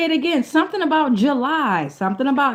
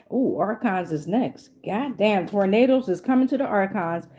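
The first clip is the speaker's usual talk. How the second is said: ordinary talk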